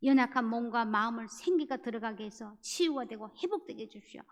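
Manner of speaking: a heartfelt plea to God, rising in force; earnest prayer. Retold or imitated. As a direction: pray with urgent intensity. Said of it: "막 간절하게 기도를 했어요".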